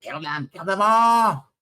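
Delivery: high, full-force battle yell; shouting goblin boss. Acted. Goblin boss ordering the attack, shouting. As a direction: roar the order, stretching the last word.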